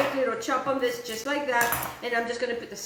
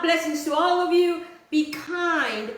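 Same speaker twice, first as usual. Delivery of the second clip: usual speaking voice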